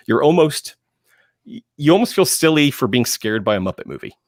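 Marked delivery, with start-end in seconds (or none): none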